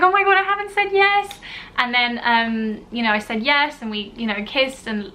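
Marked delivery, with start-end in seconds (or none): 0.0-1.4 excited gasp. Retold or imitated. Sudden realization during proposal retold.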